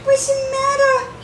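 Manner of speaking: high sing-song pet voice. Real, not acted. Cooing to the cat.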